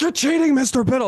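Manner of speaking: high, loud mock whine; pissed-off students accusing their coach; angry students. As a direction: whine it like cheated teenagers. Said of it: "You're like".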